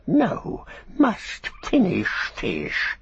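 high squealed refusal, then slow hissing; drawn-out, petulant creature voice; Gollum, stubborn creature. A narrator's reading. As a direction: whine it like a greedy wretch. Gollum refuses to leave his fish.

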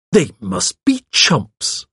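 slow, pointed disdain; wondering scorn. A narrator's reading. Secretary on people confessing falsely, wonder and scorn. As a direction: marvel scornfully at their folly. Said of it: "in a tone of wonder and scorn".

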